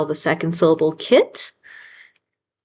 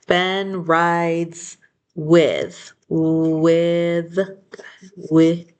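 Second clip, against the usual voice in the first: slow rereading; much slower than usual. Shown as a demonstration. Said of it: "rereading while writing".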